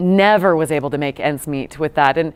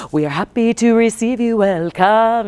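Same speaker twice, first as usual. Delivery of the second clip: a mock formal welcome, higher pitched; imitating the greeting artisan; 5 semitones higher than usual. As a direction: play the sassy artisan jumping up to greet guests.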